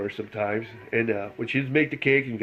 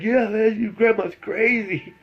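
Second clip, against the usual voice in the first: put-on high, excited kid voice, much higher; panicked kid; 10 semitones higher than usual. Cousin telling the narrator, a high frightened kid voice. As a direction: blurt it out like a scared kid who almost got shot.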